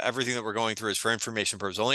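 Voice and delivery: rattled off fast in one run; rapid-fire disclaimer. Real, not acted. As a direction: race through the legal small print. Disclaimer rattled off, then repeated deliberately.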